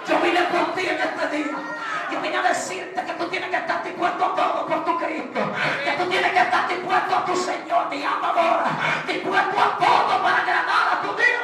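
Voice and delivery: loud exhortation, every phrase pushed out; rally the listeners to give all to Christ; preaching at full force. Acted. Preacher exhorting the church to be ready for Christ.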